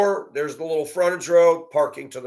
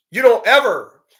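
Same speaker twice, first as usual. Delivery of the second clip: louder, higher sudden yell; sharp yell; 8 semitones higher, 8 dB louder than usual. Retold or imitated. Driver yelling at the cursing older woman, loud outburst.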